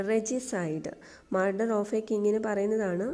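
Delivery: normal talking voice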